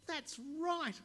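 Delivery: high, loud, delighted cry. Acted. The pleased mayor praising the boy, delighted and loud. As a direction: crow with glee. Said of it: "laughing with delight".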